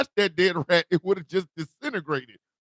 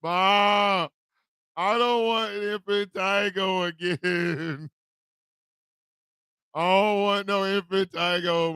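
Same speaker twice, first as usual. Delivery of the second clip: put-on slow whiny child's plea, trailing off; small boy begging mom, hammed-up whimpering; mock crying; slower than usual. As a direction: whine it like a crying kid. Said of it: "I was crying".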